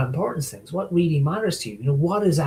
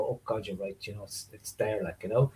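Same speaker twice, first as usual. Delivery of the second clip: low, very soft admission; muted realisation; 5 semitones lower, 12 dB quieter than usual. Acted. Conceding to people who point it out, quiet realisation. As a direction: drop right down, conceding the point under the voice.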